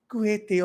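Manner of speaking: Kuwaiti said as koo-heh-tay, a rough Spanish Quixote; Spanish pronunciation. Retold or imitated. Mimicking British people showing off, Spanish-style Kuwaiti. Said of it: "they have to pronounce Spanish word in Spanish".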